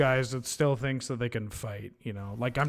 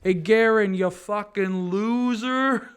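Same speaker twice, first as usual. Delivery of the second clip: loud taunt; 7 semitones higher, 5 dB louder, slower than usual. Acted. Taunting enemy champion.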